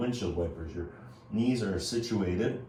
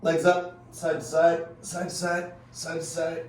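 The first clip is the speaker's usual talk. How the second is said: coach calling out; 8 semitones higher, 4 dB louder than usual. Real, not acted. Coach calling out leg moves.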